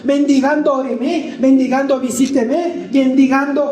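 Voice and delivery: high, urgent pulpit preaching; fervent preaching. Real, not acted. Preacher warning the congregation against drifting from God, fervent preaching.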